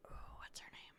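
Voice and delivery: whispered to herself; whispered aside. Real, not acted. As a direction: mutter the question under your breath. Aside while searching memory.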